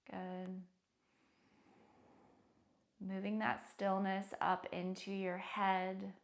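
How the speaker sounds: calm, hushed meditation guide with long pauses; soothing, quietly spoken body scan; guided meditation. Real, not acted. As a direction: gently lead them into stillness, unhurried.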